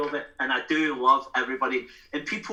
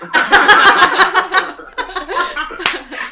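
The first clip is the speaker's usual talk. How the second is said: loud high laugh bursting out; big burst of laughter; 13 dB louder than usual. Real, not acted. Drummer cracking up at his own story, loud laugh. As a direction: crack up at your own punchline.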